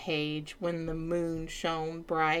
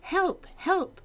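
high frantic cry for help; panicked page cry; 12 semitones higher than usual. Acted. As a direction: yell for help, pitched way up. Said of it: "cried the page".

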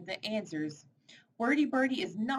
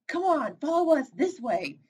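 urgent call; 7 semitones higher, 5 dB louder than usual. Acted. Friends rescue Birdie from bear.

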